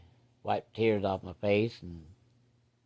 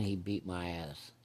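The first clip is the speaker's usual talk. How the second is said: usual speaking voice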